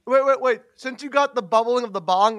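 ordinary talk, nothing marked

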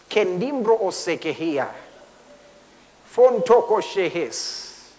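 slow, deliberate tongues; a preacher praying in tongues; speaking in tongues. Real, not acted. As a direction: let the syllables come slowly.

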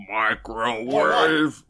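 very slow, stretched gremlin voice; gremlin-like growl. Retold or imitated. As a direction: botch an action-star impression into a gremlin growl. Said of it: "like that one gremlin".

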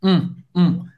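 "um" bem nasal, isolado. Shown as a demonstration. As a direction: mostre o som nasal "um". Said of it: "tem o som do um". (som "um" nasal de demonstração)

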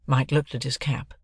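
ordinary delivery

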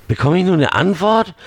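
high, fast, irritated demand; impatient demand. Acted. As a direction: press him for an answer. Angry boy demands an answer. (ungeduldig fordernd)

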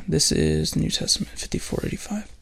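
very soft, half-voiced murmur; absently whispered number while looking it up; murmuring to self. Real, not acted. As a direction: read the number under your breath.

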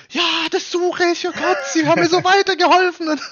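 very high, loud mock ecstatic shriek; freaking out. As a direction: gush like she just found her song. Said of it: "ist die Frau ausgerastet".

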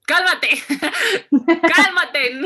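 high, loud outburst breaking into laughter; loud comic self-scolding with laughs; exclaimed, laughing. Real, not acted. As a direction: shout it at yourself and crack up. Blurts advice to her future self.

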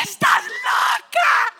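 very high, drawn-out yell; outraged shout. Retold or imitated. Girl yelling at the waxer, outraged high shout. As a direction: shriek it in mock outrage.

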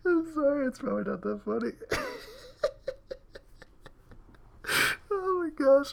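high, squeaky talking through laughter; helpless giggles choking the words; real laughing fit. Real, not acted. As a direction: apologise while cracking up. Cracking up at own story.

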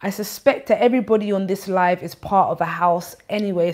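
usual speaking voice

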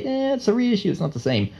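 high mocking "Eh" brush-off; dismissive scoff. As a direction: wave it off like a snob. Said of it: "So when people say".

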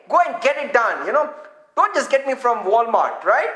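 normal talking voice

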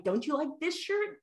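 lightly high, wheedling question; coaxing mom. Retold or imitated. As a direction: sell the shirt to your son sweetly. Mother pushing shirt on son.

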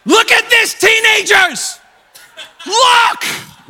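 high, loud warning holler; hammed-up exasperated shout; mock preaching shout. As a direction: yell it out to the youth like a frantic preacher. Imagined preaching with bawling child.

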